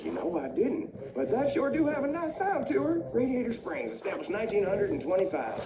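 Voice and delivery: put-on high-pitched old-time car voice; Stanley. Old car names the new town, high character voice. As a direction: muse aloud high, then proclaim it. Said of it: "Stanley says".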